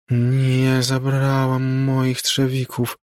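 put-on high, slow, otherworldly child ghost; ghost girl's voice. A narrator's reading. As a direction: drift in and out like a far radio. Ghost repeats her words.

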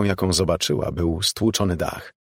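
ordinary delivery, nothing marked

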